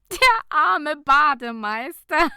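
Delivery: high, loud words bursting with laughter; laughing out loud. A narrator's reading. Nicola laughing about the poor lifeguard, loud laughter. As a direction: laugh it out helplessly. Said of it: "lachte Nicola laut los".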